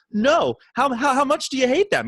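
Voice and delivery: imitating a bubbly friend, pitched high. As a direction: play the clueless, bubbly questioner. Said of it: "He was so bubbly".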